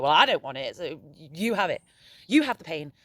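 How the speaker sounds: loud, sharp refusal, shoving the pain onto someone; a petulant, loud handoff of blame; resentful push-off. Pretend. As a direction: toss it away: you have it. Acting someone pushing pain away.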